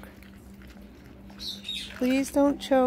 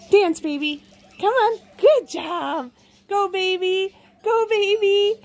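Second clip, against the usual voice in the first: higher singsong coaxing to a bird; 5 semitones higher than usual. Real, not acted. Coaxing the parrot to dance.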